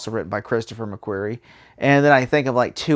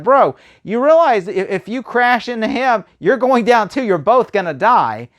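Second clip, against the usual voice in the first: pitched up, disbelieving "bro, come on"; exasperated retort; 8 semitones higher, 4 dB louder than usual. Retold or imitated. Reviewer mocking the villain's chase, exasperated and higher. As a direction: talk sense into a reckless pilot.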